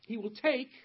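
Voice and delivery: "take" punched out high and loud; stress on take. Shown as a demonstration. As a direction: lean heavily on "take", then stop. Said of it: "did you notice the emphasis on the word".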